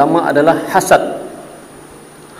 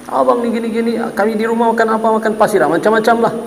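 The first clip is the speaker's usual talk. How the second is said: wife's nagging; 6 semitones higher than usual. Retold or imitated. Wife nagging husband.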